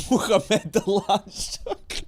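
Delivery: high, slow, through laughter; laughing. Real, not acted. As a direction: say it cracking up. Joke name, laughing.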